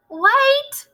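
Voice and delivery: high piercing shriek. Acted. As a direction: shriek it out, sudden alarm. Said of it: "shrieked one of the witches".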